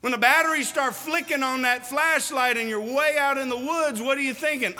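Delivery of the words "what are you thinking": normal talking voice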